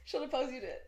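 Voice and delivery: ordinary delivery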